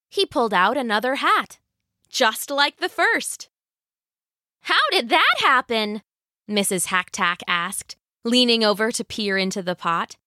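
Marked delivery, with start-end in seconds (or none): 4.6-6.0 high-pitched, mild astonishment; lightly acted puzzled wife; amazed wife. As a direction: ask in raised-pitch wonder.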